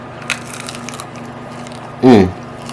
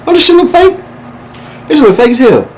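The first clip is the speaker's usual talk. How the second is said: high, incredulous squeal; shocked it looks fake; surprised; 13 semitones higher than usual. Real, not acted. Tasting, suspicious of the chicken.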